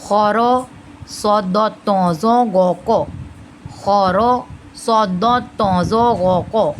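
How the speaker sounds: slow "खौरो सौदो तौजो गो को", every "o" rounded; drawn-out heavy syllables in "तौजो गो को"; heavy-letter mnemonic. Shown as a demonstration. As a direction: recite "खौरो सौदो" slowly and full-mouthed. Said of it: "अदायगी भी इस तरीके से ही होगी".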